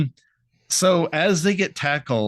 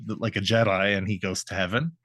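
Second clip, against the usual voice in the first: usual speaking voice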